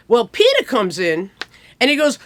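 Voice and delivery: ordinary delivery, nothing marked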